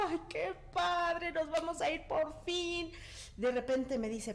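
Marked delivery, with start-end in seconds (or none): 0.1-2.9 high, slow, teary delight; mock-tearful happiness, drawn out high; tearful joy. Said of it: "Y yo así llorando". (alegría entre lágrimas, aguda y lenta)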